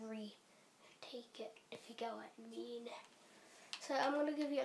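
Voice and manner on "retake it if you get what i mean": whispered aside, very slow; whispering. Real, not acted. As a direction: drop to a whisper. Aside about deleted video.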